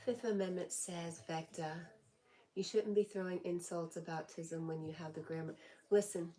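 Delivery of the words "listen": louder, slow firm scolding; stern, slow warning to the rude chatter. Real, not acted. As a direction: lay down the law to a troll.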